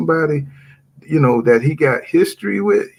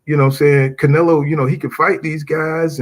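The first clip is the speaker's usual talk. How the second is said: usual speaking voice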